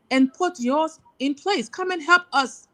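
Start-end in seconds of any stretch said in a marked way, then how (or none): 1.7-2.7 higher, urgent call to help; fervent appeal to the diaspora, pitched up; impassioned plea. Real, not acted. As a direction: lift the voice and beg for help.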